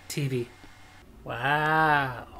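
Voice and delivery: ordinary talk, nothing marked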